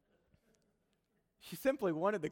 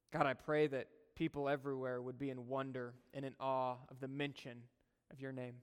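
heartfelt prayer; 3 semitones lower, 4 dB quieter than usual. Acted. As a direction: pray quietly, drawing out the words. Prays aloud to God.

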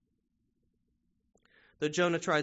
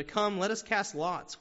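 higher, louder and slower, urging; slow, raised plea to cast lots; panicked sailors; 6 semitones higher, 5 dB louder, slower than usual. Retold or imitated. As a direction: call out like frightened sailors in a storm. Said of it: "are still panicking".

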